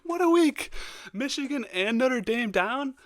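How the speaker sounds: very high and delighted, slowing on "down"; gleeful gloating. Real, not acted. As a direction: crow over the rivals' losses. Gloating over rivals losing.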